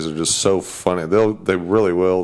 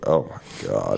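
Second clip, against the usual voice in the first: weary groan; 13 semitones lower, 5 dB quieter, slower than usual. Retold or imitated. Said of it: "just like".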